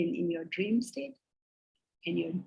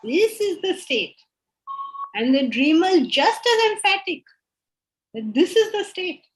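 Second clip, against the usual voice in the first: emphatic insistence; 9 semitones higher, 7 dB louder than usual. Retold or imitated. Teacher voices the dreamer's conviction.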